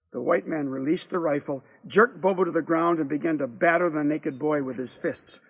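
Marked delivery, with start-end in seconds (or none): none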